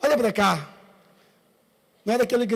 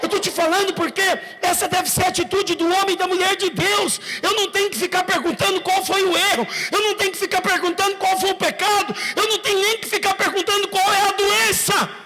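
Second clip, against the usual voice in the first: preaching at full force; 8 semitones higher than usual. Acted.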